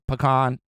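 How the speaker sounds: "pecan" said puh-KAHN. Shown as a demonstration. Host returning to the other way, "pecan" as puh-KAHN. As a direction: end "pecan" on a broad KAHN. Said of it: "whatever way you say it".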